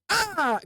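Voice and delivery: sudden high-pitched "ah"; high imitated cry. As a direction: act out the moment with a high cry. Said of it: "where it was like".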